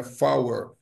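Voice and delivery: "four" said as two syllables, FOW-er; "four" like fower, radiotelephony style. Shown as a demonstration. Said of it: "o número quatro em radiotelefonia não é for".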